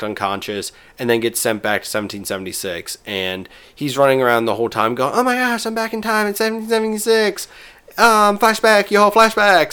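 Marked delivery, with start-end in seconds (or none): 5.1-9.7 much higher, excited cartoon boy; mock Cartman, high and thrilled; cartoon kid voice. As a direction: squeal it like a giddy kid lost in time.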